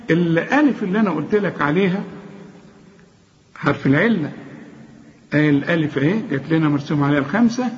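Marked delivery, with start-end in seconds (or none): none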